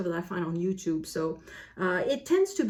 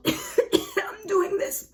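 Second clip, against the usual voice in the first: very high, dragged-out strained voice; hammed-up exhausted groan of resolve; mock weary; 13 semitones higher, much slower than usual. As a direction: sound sick and forcing yourself. Weary April after covid.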